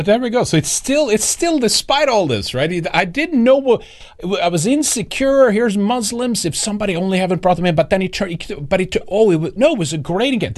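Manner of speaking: high-pitched mocking parody of the guest. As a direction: squeak it out in a sarcastic imitation of the guest. Parodies the guest's synagogue story.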